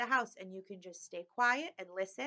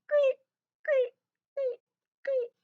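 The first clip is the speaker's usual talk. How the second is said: very high, louder cricket chirps; chirping crickets; 7 dB louder, slower than usual. A narrator's reading. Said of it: "The crickets go".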